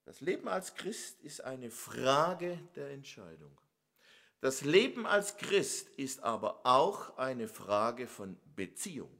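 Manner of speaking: ordinary talk, nothing marked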